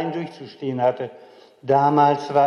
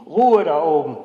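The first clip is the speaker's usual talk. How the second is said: shouting up; 4 semitones higher, 6 dB louder than usual. Retold or imitated. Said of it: "Manchmal rief Onkel Ewald von unten herauf".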